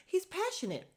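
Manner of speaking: very high-pitched imitated protest; mock defensive wife. Retelling wife defending her husband, mock defensive. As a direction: pipe up high in his defence. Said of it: "Jasmine is like".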